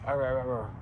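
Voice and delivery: "Arr, arr, arr, arr" as a strained, stuck R; mock struggling R. Shown as a demonstration. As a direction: grind out "Arr" four times. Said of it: "now it's like".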